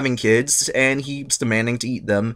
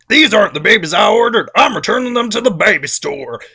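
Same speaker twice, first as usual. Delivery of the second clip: raised-pitch huffy customer complaint; Uranus voice; 9 semitones higher, 5 dB louder than usual. Retold or imitated. Uranus rejecting his babies as a huffy customer. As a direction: whine like returning a bad order.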